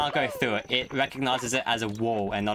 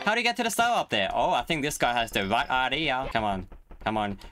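usual speaking voice

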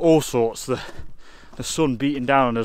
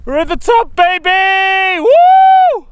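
high, loud victory yell; triumphant shout; 12 dB louder, much slower than usual. Real, not acted. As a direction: scream it from the summit. Reaching the summit, cheering.

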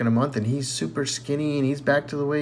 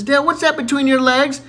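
put-on innocent child; 9 semitones higher, 4 dB louder than usual.

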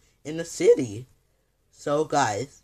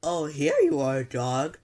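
usual speaking voice

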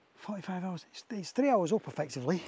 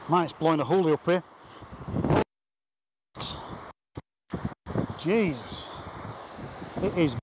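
shouting over wind. Real, not acted.

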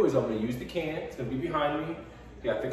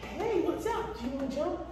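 high, sing-song dog-praise voice; squeaky praising a dog; 9 semitones higher, slower than usual. Real, not acted. Trainer talks to dog again.

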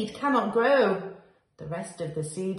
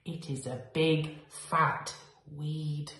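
plain reading voice

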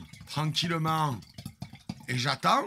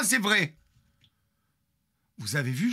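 ordinary delivery

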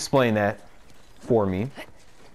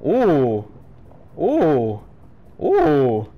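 excited ooh; 4 semitones higher, 6 dB louder, much slower than usual. Real, not acted. Hyping up a sneak attack.

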